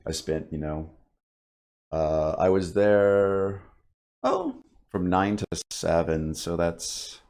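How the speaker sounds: hoarse, gravelly voice after ten hours of yelling. Real, not acted. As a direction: let the gravel show on the line ends. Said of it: "sorry about my, my gravelly voice".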